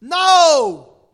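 loud, sharp denial; loud preacher's no; emphatic shout. Real, not acted. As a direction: bark the no at the crowd. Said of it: "rebuttal shout".

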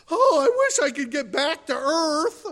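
loud, high-pitched hammed-up wishing; mock longing. Preacher imagining Lazarus in heaven, loud mock longing. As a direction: wail it in mock yearning. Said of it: "Lazarus was in heaven going".

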